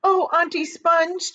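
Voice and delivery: put-on high, slow pleading boy; small boy crying out; James pleading. A narrator's reading. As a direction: beg the aunts. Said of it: "James cried out".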